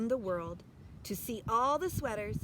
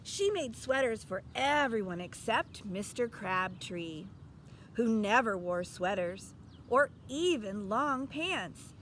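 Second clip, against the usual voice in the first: ordinary delivery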